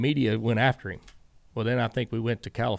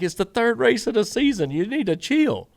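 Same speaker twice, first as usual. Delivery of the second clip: exasperated; 11 semitones higher, 6 dB louder than usual. Retold or imitated. Exasperated reply to driver.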